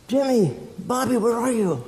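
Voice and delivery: put-on high, far-carrying call for the boys; a father's distant call. A narrator's reading. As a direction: call out into the dark for your sons. Father calling lost sons.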